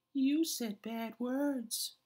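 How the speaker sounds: high, slow hurt little squeak; reproachful. A narrator's reading. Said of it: "his voice filled with reproach".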